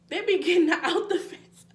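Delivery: high, loud, laughing mid-sentence. Real, not acted. Vlogger amused by escaping cows, laughing loudly. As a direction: burst into a real laugh while telling it.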